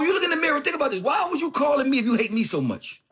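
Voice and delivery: loud, pressing question; heated demand. Real, not acted. Rapper confronting a rival about his calls, loud and heated. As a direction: demand an answer from him. Repeats question, then mocks it.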